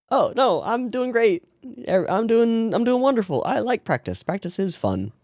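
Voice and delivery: high, bright fake-cheerful voice; mock-chipper girl covering hurt; forced cheer. Voicing Ahiru's forced cheer.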